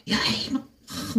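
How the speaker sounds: high-pitched mock yell; half-voiced imitation of shouting; retold yell. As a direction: imitate a heckler crying out, without full volume. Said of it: "막 소리를 지르면서".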